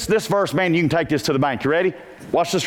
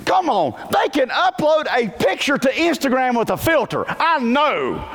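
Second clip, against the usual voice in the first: incredulous; 9 semitones higher than usual. Real, not acted. Incredulous jab at teens.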